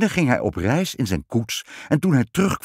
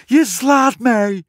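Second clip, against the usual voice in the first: very high, slow, loud cry of delight; overjoyed queen squeal; elated; 12 semitones higher, 6 dB louder, much slower than usual. A narrator's reading. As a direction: exclaim rapturously, drawing out each word. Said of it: "riep ze opgetogen".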